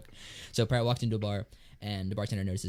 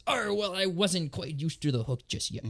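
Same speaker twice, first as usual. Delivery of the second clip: slow higher pirate punchline; 8 semitones higher, slower than usual. Acted.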